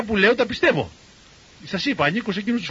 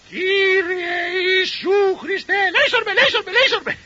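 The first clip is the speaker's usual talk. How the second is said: piercingly high parrot voice, first words stretched out; parrot reciting prayer; 14 semitones higher, 4 dB louder, slower than usual. Retold or imitated. Parrot repeating the prayer for the listening doctor, high squawking imitation. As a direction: mimic a parrot's squawked prayer, very slow opening. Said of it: "Τρεις φορές πάλι ο παπαγάλος".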